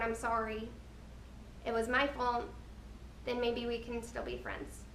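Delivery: normal talking voice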